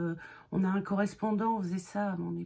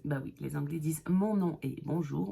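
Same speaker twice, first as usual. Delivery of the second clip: normal talking voice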